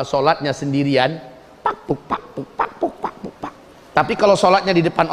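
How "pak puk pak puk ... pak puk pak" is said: mock rapid prayer noises. Mimicking hasty prayer sounds.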